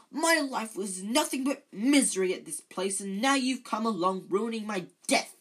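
loud, high self-pitying wail; howling. A narrator's reading. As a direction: wail out every grievance. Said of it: "howled Myrtle".

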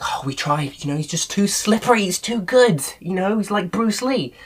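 high-pitched hammed-up excuse; comically overwrought mock protest; mock excuse-making. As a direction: play a guard making feeble excuses, pitched right up. Acting out guards' imagined excuse.

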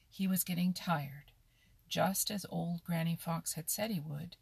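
usual speaking voice

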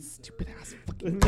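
frustrated whispered curse; grumpy whisper. Real, not acted. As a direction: hiss the curse under breath. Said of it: "You're all grumpy about it".

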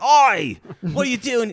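high, loud British "Oi!"; startled scientist yelling at intruders; hammed-up startled Brit yelling; cockney shout. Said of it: "And he's like".

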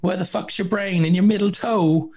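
a lifted, high put-on girl voice; mock contestant. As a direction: squeak it up as the daft contestant. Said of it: "She goes".

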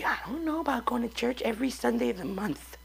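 whispered reluctant churchgoer. Retold or imitated. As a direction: mutter it like a doubter under breath.

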